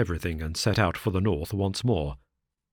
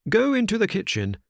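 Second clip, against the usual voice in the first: out loud; 14 semitones higher, 3 dB louder than usual. Acted. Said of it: "he continued out loud".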